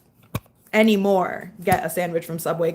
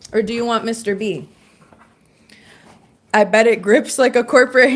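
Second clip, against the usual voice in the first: ordinary talk, nothing marked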